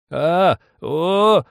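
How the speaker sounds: loud, high wordless wail; old man's cry. A narrator's reading. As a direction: cry out long and high from the stove. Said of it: "кричал на печке старик".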